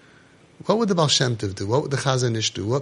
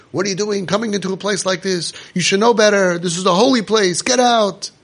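raised, higher-pitched indignant yelling; retold screaming; 4 semitones higher than usual. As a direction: yell like an outraged man defending a holy place. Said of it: "he starts screaming".

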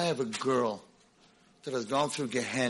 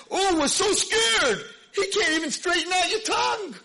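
mocking shout; 6 dB louder than usual. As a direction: jeer at him like a scornful king. Paro mocking Moshe's God.

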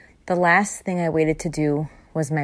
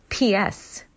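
ordinary delivery

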